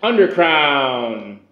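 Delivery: slow, drawn-out trailer voice; movie trailer guy. Pretend. Host finishing the mock trailer for whiskey and cigar, slow announcer. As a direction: stretch each phrase like a hammed-up announcer. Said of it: "I can do the movie guy".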